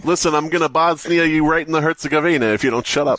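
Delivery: mock tough Serb. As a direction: play a joking tough guy. Playful mock Serb threat.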